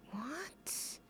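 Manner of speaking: breathy shocked whisper. Real, not acted. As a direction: whisper it in disbelief. Shocked by the music.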